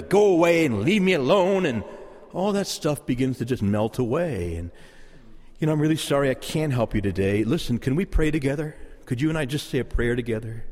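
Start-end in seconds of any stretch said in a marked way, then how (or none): 0.0-1.7 angry. Retold or imitated. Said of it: "all the anger and the". 5.7-10.6 lower, gently apologetic offer; gentle refusal. Acted. Passer-by kindly turning down a beggar, gentle and apologetic. Models a kind answer to beggar.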